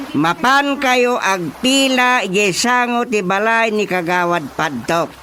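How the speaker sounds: angry scolding. Acted. Chairman angrily sending villagers to the councilor's house. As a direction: drive them off angrily.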